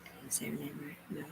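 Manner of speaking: soft unvoiced whisper aside; hushed whispered question. Real, not acted. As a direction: whisper it to yourself.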